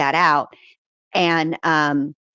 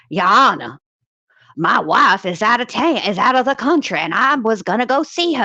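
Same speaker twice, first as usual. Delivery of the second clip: Foghorn Leghorn impression; 5 semitones higher than usual. Lawyer begging the judge to spare his trip, in a Foghorn Leghorn voice. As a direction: ham up a pompous Southern lawyer pleading to the judge. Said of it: "like Foghorn Leghorn and all his Foghorn Leghorn glory".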